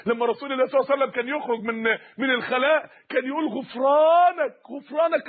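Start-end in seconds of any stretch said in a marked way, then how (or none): 3.4-5.3 long-drawn, raised plea for pardon; imploring cry. Retold or imitated. The Prophet on coming out asks forgiveness, drawn-out imploring call. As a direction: stretch the word out in supplication.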